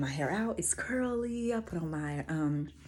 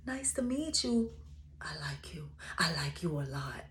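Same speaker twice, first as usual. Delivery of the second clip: whispering; 2 semitones lower, 7 dB quieter than usual. Retold or imitated. Imagined hug message, whispered.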